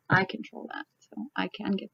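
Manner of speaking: whispered teasing retort; whispering. Real, not acted. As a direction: hush it like a playful aside. Teasing someone over control.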